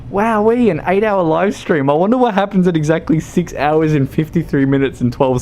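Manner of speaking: high, eager mock-viewer voice; imagined viewer. Viewer skimming the livestream, high mock-curious voice. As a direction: imitate a wide-eyed browser, quick and high. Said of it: "they're just like".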